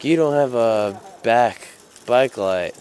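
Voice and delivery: slow, loud cop voice; stern cop. Retold or imitated. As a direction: lay it down like a cop. Cop stating the violation.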